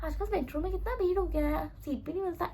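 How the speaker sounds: soft, offhand casual remark. Shown as a demonstration. Right way to open small talk on the metro, casual. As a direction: toss it out quietly, nothing dramatic. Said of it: "एकदम general बोलना है".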